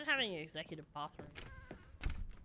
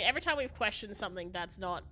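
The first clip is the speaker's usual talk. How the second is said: ordinary talk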